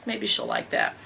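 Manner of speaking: ordinary talk, nothing marked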